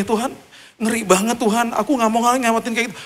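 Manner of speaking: high, frightened plea to God; pleading in fear. Retold or imitated. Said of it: "saya kan takut dong". (doa ketakutan dengan suara tinggi)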